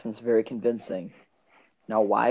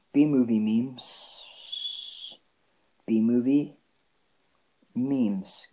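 very slow, last word stretched long; absent-minded, dragging "B-movie memes" out; drawn out; much slower than usual. Real, not acted. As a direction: stretch out "memes" while busy searching. Drawing out words while searching phone.

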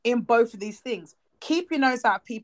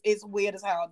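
normal talking voice

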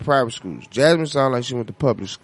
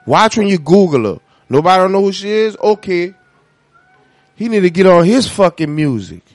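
indignant; 3 semitones higher, 9 dB louder than usual. Real, not acted. Indignant rant about [name].

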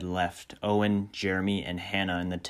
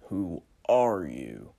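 western stranger drawl; much slower than usual. Acted. Stranger asks.

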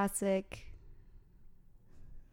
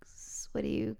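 breathy whispered aside; 7 dB quieter than usual. Real, not acted. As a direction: whisper it to yourself.

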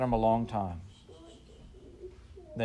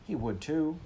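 high, indignant retort; indignant boy; 7 semitones higher, slower than usual. A narrator's reading. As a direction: snap back like a stung kid. Boys arguing.

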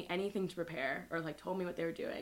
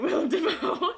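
laughing; 11 semitones higher, 11 dB louder than usual. Real, not acted. Laughing at own joke.

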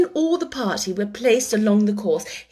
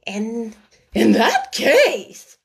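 slow, louder, ponderous bird; solemn Dodo; 5 dB louder, much slower than usual. A narrator's reading. The Dodo rises to address the meeting, solemn. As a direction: rise and pronounce it gravely. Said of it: "said the Dodo solemnly".

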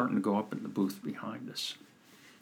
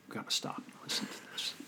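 a hushed whisper to the person beside you; whispered aside; 13 dB quieter than usual. Retold or imitated. Coach whispers to his client about the booth behind, hushed. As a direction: whisper so the next booth can't hear.

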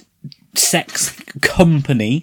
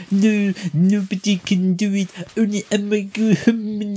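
mock French accent; 8 semitones higher than usual. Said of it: "And she's like".

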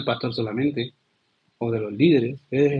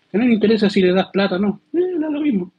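dismissive shrug; 7 semitones higher than usual. Acted. Dismissive aside in sermon.

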